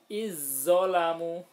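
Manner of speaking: "Izzolamu" chanted slow and loud with a long doubled z; merged za into zo. Shown as a demonstration. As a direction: recite "Izzolamu" holding the heavy z, vowels drawn out. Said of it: "za-nya dihilangkan, zo-nya ditasdidkan".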